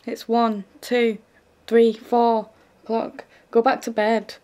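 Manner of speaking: loud, yelled through the door, slow on three, four; shout. Acted. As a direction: bellow the hours at her. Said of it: "I'd shout".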